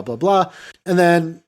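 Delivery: ordinary talk, nothing marked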